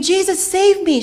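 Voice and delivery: put-on drowning girl begs Jesus, desperate cry. A narrator's reading. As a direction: cry out to be saved. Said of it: "She wanted to scream".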